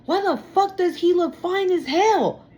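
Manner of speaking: voicing the envious person's whining complaints; mock jealous rant. Tarot reader voicing jealous person, high whiny indignation.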